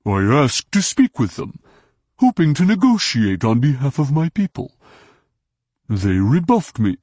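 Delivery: lightly voiced aggrieved Hutt explaining; an alien pleading he meant well. Hutt explaining to Jaina he tried negotiating, aggrieved.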